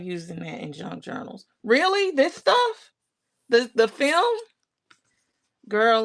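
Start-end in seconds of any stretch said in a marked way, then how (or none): 1.6-4.4 surprised. Real, not acted. Surprised at a viewer's comment.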